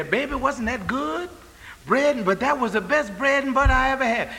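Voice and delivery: high, delighted voice praising the bread; pleased diner. Retold or imitated. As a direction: squeal about the best bread ever. Preacher voicing a pleased diner.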